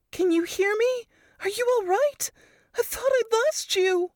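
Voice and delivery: high, breathless worried friend; Varys worried. A narrator's reading. Friend frantic after bomb blast.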